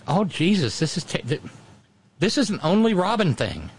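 high, exasperated "oh Jesus"; a burst of dismay at yourself; dismayed exclamation. Retold or imitated. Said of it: "And I'm like".

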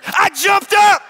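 loud, high storytelling; excited shouting. Acted. Pastor tells congregation how he almost fought the waiter, shouting. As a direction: build it up, about to fight. Telling the near fight.

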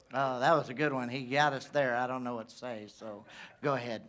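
low, quiet, defeated mumble; feeble comeback. Acted. Stumped crowd answering Elijah, low sheepish surrender. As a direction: give in meekly, trailing away to almost nothing. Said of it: "They ain't even got any comebacks".

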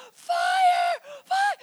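high, panicked-alarm scream; acted scream, very high and loud. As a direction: scream it at full volume. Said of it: "quietest person would scream".

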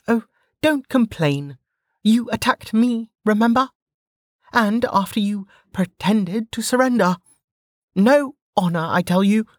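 put-on high-pitched brash young woman; Velika's voice. A narrator's reading. Velika scolding the downed sentinel, high and cocky. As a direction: toss it off, cocky and unbothered.

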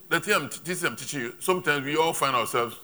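normal talking voice

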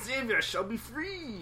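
slow, lofty Norse hero voice; Thor. Pretend. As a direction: proclaim it grandly, slowly. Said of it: "so overdone".

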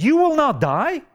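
"You will not die" pitched very high; emphatic. Retold or imitated. Satan to man in the garden, high emphatic "not die". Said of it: "began to speak with emphasis".

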